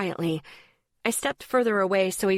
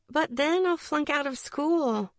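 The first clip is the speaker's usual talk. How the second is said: much higher whiny complaining voice; 8 semitones higher than usual. A narrator's reading. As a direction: whine it like a sulky kid. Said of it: "I pointed out in a whiny voice".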